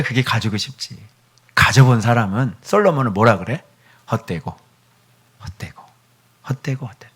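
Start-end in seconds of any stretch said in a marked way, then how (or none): 4.1-7.1 much softer, lower, fading; weary lament. Acted. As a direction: let each repetition sink away. Solomon's vanity lament.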